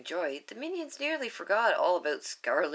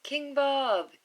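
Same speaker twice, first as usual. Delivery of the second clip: high, louder minion shout; 9 semitones higher, 4 dB louder than usual. Acted. Bob shouting to the crowd below the balcony. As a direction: call out his own name proudly. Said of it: "he shouts at last".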